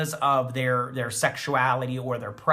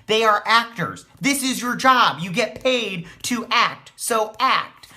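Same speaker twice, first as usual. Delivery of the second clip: heated, raised-voice rant, higher and louder; 9 semitones higher, 6 dB louder than usual. Real, not acted. Reviewer rants at the show's actors, heated and loud. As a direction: lecture the actors, fed up and loud. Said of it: "my little rant".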